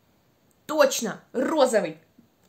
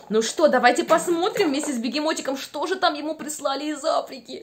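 normal talking voice

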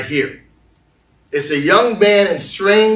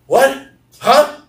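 acted startled yelp, high and loud; high-pitched, jolted double take; 8 semitones higher, 5 dB louder than usual. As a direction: blurt it as the stunned women. Acting the startled women at tomb.

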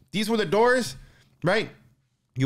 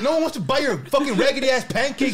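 mock telling off; 8 semitones higher than usual. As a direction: snap back at him in a raised voice. Telling off the crackhead, retold.

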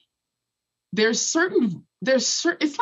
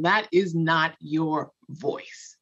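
slow, low, deliberate restatement; slow and weighty repeat; said again for emphasis; 5 semitones lower, much slower than usual. Shown as a demonstration. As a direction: lean into every word. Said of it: "I'm gonna say that again".

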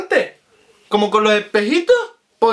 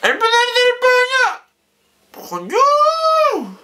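sudden high-pitched yelp; shouting; 12 semitones higher, slower than usual. Real, not acted. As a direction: shout it out in pain. Goggles pulling the face. (grito agudo)

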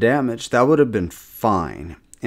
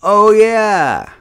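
high, loud cry of sudden relief; mock elated realization; 11 semitones higher, 7 dB louder than usual. Gamer acting out remembering the undead defense, mock elated. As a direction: belt it out as it dawns on you.

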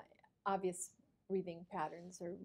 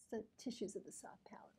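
whispering; 3 semitones higher, 10 dB quieter than usual. Real, not acted. Whispered remark during the exam.

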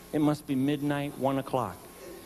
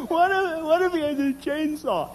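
high, fretful wife voice; panicky wife; 13 semitones higher than usual. Retold or imitated. Wife fretting to husband about an intruder, high and panicky. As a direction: fret it out high, clinging to him.

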